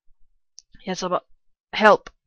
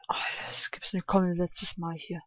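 normal talking voice

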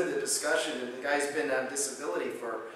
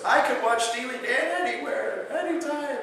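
much higher, lighter voice of the keen patient; retold patient pleading lightly; eager patient; 11 semitones higher, 4 dB louder than usual. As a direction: play the patient, bright and keen. Patient quoted, keen to continue.

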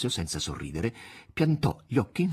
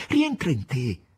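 higher, pleading, slowing to the end; frightened; 9 semitones higher, slower than usual. A narrator's reading. Margherita shaking the master, high frightened pleading. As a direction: urge him back to his senses. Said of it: "disse Margherita spaventata".

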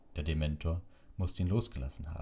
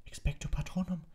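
shouted spell; 13 semitones higher than usual. A narrator's reading. Harry shouts the spell over the screaming in his ears. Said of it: "rief Harry".

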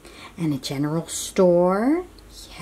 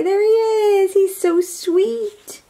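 much higher, cooing delight; higher sweet gushing, slowed; 11 semitones higher, 3 dB louder than usual. Real, not acted. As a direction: gush over a favorite. Delighted by favorite character.

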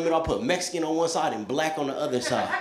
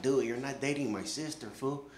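menacing dudes; 5 semitones lower, 10 dB quieter than usual. Retold or imitated. Dudes threatening him.

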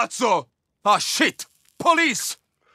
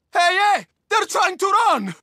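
raised, higher call to raise the alarm; alarmed shout; 10 semitones higher than usual. Acted. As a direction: shout a warning across the yard. Enemies fleeing, alerting others.

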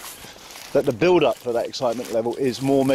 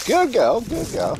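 praising the dog; 10 semitones higher, slower than usual. Real, not acted. Owner praising Lola for staying close, high praise.

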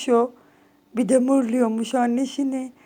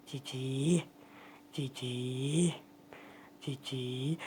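much softer, lower cooing; 10 semitones lower, 16 dB quieter, much slower than usual. Real, not acted. Owner softly cooing cici to calm her cat. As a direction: murmur it gently, almost gone.

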